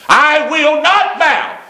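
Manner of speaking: high, drawn-out defiant cry; defiant refusal. Acted. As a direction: refuse at full voice, stretching each word. Preacher voices a defiant unbeliever.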